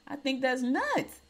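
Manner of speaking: slow, high-pitched "that's nuts"; incredulous outrage. Real, not acted. Host reacting to the jailing in Dubai, slow and incredulous. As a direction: stretch it out in disbelief.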